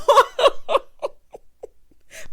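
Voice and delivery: loud, high-pitched cackle; helpless loud laughter; big laugh. Real, not acted. Laughs hard at the scene.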